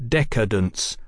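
decadence with all three syllables stressed alike; evenly hammered syllables in decadence; equal stress on decadence. A narrator's reading. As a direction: stress each syllable of "de-ca-dence". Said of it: "giving equal stress to the word's three syllables".

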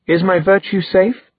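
high, coyly theatrical question; hammed-up romance heroine; mock sultry maiden. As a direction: play the imperilled innocent, teasingly. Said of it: "She gave him a sultry look".

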